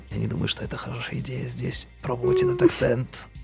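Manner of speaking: ordinary delivery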